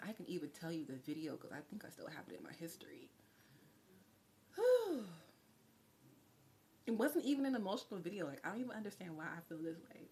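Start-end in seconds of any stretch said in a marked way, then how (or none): 4.6-5.0 loud, high puff of breath; real whew against rising tears; tearful whew. Real, not acted. Streamer overcome during a reading, letting out a tearful whew. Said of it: "what I'm crying about".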